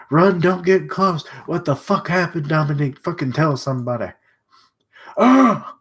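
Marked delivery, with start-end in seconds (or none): 5.2-5.8 sudden high loud cry; startled yell. Real, not acted. Reacting to a sudden headshot in game with a yell. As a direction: jolt out a loud high yelp.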